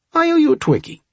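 playful offer in a high voice; high teasing dad voice. Acted.

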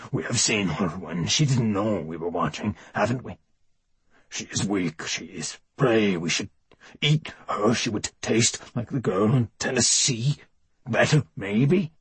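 a hungry beast inside the man; monster voice. A narrator's reading. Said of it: "the voice of Wellesley's monster".

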